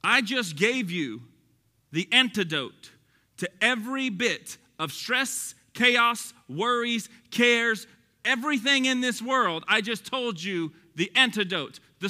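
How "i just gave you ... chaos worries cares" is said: slow, deliberate restatement; slow, clear repetition. Real, not acted. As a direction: spell it out slowly, pausing before "antidote". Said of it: "Maybe I'm not communicating clearly".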